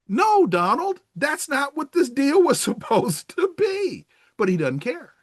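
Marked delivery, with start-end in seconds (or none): 0.1-4.1 higher, laughing reproach to Donald; mock voter scolding.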